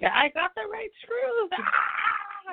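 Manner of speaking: high, slow, goofy shout; goofy Conor impression. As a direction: ham up a cartoon plumber. Imitating Conor as a plumber.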